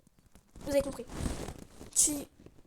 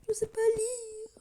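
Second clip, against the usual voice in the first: louder, higher, half-crying despair; comic wail; 7 semitones higher, 4 dB louder than usual. Real, not acted. Reader laughing at her own stumbles, mock crying wail. Reader fumbling the script, wails.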